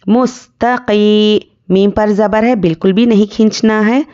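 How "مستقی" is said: مستقی recited slowly, long ee at the end; elongated yaa madd. Shown as a demonstration. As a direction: hold the final ee of مستقی for one alif. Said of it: "ایک الف کے برابر کھینچ کر پڑھیں گے".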